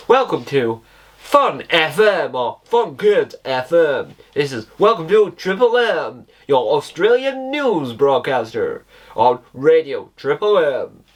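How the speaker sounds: cheesy radio DJ voice; bright radio announcer read. Pretend. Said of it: "It sounds really radio-fied".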